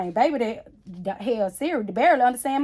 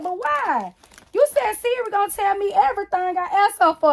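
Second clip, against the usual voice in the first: put-on child protesting; 9 semitones higher, 6 dB louder than usual. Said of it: "She said".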